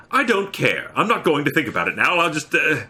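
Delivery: high, insistent refusal petering into hesitation; flustered protest. Acted. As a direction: dig in, then lose the thread. Arthur's flustered argument in the story.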